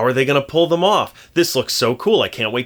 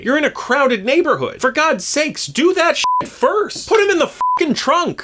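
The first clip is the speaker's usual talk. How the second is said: high-pitched, incredulous scolding; exasperated rant; 11 semitones higher than usual. Real, not acted. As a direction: berate them in high-pitched disbelief. Exasperated rant at film characters' stupidity.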